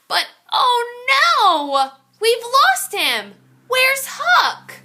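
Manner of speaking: high, slow cry of alarm. A narrator's reading. Narrator reading to children, alarmed as the goat falls. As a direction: gasp it out in shock.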